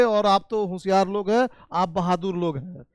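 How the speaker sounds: normal talking voice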